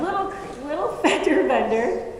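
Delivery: mock his phrase "little fender bender" back at him; playful mimicry of "Little, little fender bender"; mocking echo. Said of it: "He didn't know that".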